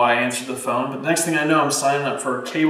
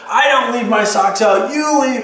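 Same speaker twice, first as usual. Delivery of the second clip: mock defensive retort; 8 semitones higher, 3 dB louder, slower than usual. Acted defensive spouse retort.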